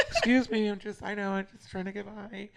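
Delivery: retold meek pleading. Storyteller trying to get past the girl, high mock-meek plea. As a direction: squeeze past with a mock apologetic voice. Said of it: "I'm like".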